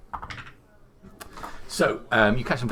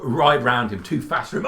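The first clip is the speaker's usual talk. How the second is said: usual speaking voice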